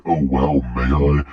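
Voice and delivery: ordinary talk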